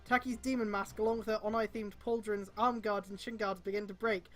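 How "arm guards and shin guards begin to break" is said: usual speaking voice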